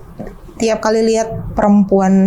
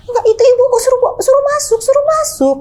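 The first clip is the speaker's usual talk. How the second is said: much higher, near crying insistence; imitating father insisting. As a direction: plead high like a confused old man near tears. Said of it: "kata dia gitu".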